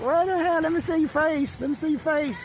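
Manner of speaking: high put-on whiny demand; high mock-insistent voice; pestering viewer. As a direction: mimic a nagging viewer way up high. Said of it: "I know you're like".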